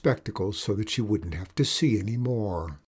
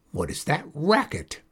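screamed; 3 semitones higher than usual. A narrator's reading. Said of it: "screamed Critch".

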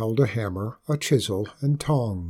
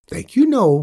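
much higher, louder troll call; 9 semitones higher, 7 dB louder than usual. A narrator's reading. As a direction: call back from the doorway. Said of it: "called the troll".

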